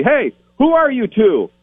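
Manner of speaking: high, slow challenge called down a long hallway; distant guard calling out. Retold or imitated. Stranger catching intruders backstage, calling out high and slow. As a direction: call out suspiciously from far off. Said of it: "some guy down at the end of the hall say".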